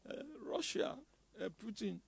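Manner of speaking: soft, whining mock plea to World Bank; mock begging. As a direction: play a meek beggar nation, half-joking. Mocking a nation begging abroad.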